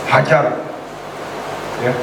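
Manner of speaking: usual speaking voice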